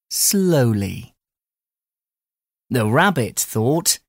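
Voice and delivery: usual speaking voice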